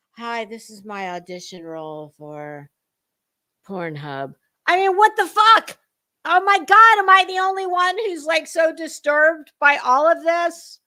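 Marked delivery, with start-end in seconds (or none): 0.1-4.3 low, breathy, seductive parody; sultry mock voice. 4.7-8.7 loud, high exasperated outburst; outraged shout. Real, not acted. Outraged reaction to streamer.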